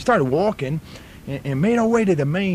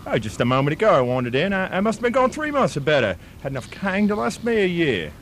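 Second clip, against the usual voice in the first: broad put-on Australian bloke spinning a yarn; Australian accent. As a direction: play an outback Australian storyteller.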